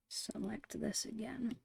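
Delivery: ordinary delivery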